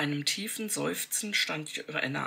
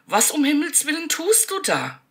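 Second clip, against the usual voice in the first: much higher and louder, appalled demand; horrified shout; 12 semitones higher, 9 dB louder than usual. A narrator's reading. Joanna confronts Michael over the maid, shouting appalled. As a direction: shout in disbelief and horror. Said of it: "rief Joanna entsetzt".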